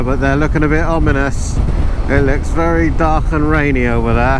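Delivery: helmet mic over engine noise; talking through a helmet while riding; inside a motorcycle helmet. Real, not acted.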